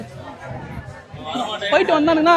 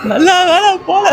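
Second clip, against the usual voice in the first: laughing shout; 7 semitones higher, 3 dB louder, slower than usual. Real, not acted. Shouting while watching ride.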